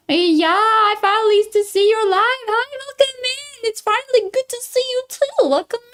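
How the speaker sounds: high-pitched cutesy greeting; high squeaky voice. Pretend.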